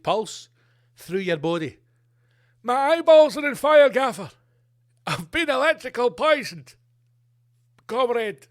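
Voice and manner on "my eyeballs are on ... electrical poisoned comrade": high, loud, hammed-up cry of pain; mock burning victim. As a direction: overplay a poisoned soldier yelling to his boss. Victim voiced, burning eyes.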